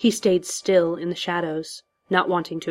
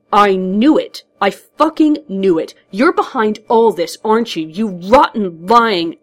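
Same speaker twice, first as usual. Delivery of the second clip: loud, triumphant accusation; angry outburst; 4 semitones higher, 8 dB louder than usual. A narrator's reading. Hero accuses villain of being behind it, angry outburst. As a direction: explode into a raised-voice accusation.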